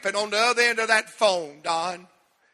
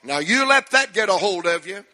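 ordinary talk, nothing marked

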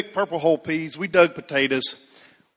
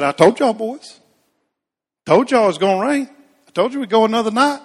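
teasing dad; 6 semitones higher than usual. Retold or imitated. Dad teasing boys after rain.

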